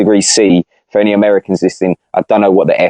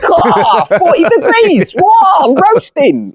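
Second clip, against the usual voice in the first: much higher, mock-outraged British bloke; shocked Brit; slower than usual. Brit imagining forty degrees heat, high mock shock. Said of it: "we're thinking".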